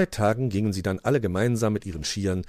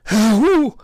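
loud, high yelp of fright; startled hoot; 14 semitones higher, 12 dB louder than usual. A narrator's reading. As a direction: jolt awake with a hoot. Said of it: "erschrak Willi".

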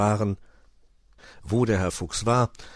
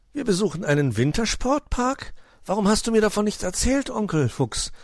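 put-on high, thrilled young nephew; giddy, high-pitched astonishment; beside himself with excitement; 13 semitones higher, 4 dB louder than usual. A narrator's reading. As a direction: burst out with delighted questions. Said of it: "war Weißöhrchen völlig aus dem Häuschen".

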